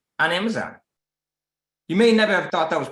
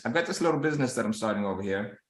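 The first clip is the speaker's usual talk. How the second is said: downplaying; 4 semitones lower, 6 dB quieter, faster than usual. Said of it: "they're downplaying their business".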